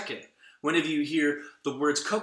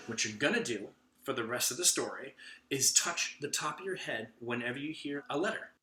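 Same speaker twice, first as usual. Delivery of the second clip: ordinary delivery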